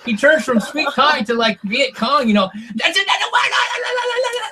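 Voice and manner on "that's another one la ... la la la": high, wild mock chant; going crazy. Castaway gone crazy over the eel, wild high chanting. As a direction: ham up a frenzied war cry. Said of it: "starts going crazy".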